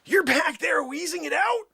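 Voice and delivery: normal talking voice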